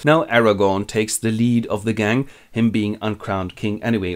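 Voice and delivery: normal talking voice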